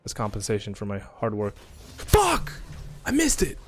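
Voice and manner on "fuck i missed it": much higher pained cry; high frustrated yelp. Real, not acted. Gamer missing his shot, much higher frustrated yelp. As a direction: yelp at the miss. Missed a skillshot.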